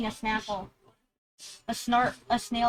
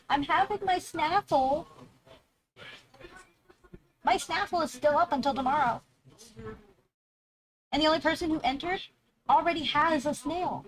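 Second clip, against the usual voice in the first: high, bright hype; excited announcing; 6 semitones higher than usual. Real, not acted. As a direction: pitch it up to sell.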